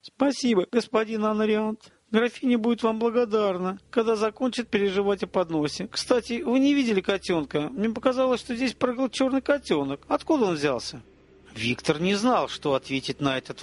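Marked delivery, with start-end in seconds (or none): 0.0-11.0 high-pitched lady's register; lightly feminine, raised pitch; woman's voice. A narrator's reading. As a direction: speak higher as a gracious lady. Veretta's voice in the story.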